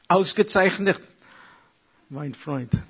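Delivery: playful German exclamation to a friend; light put-on German greeting; mock German. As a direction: toss off the German words with a grin. Showing friend a German souvenir.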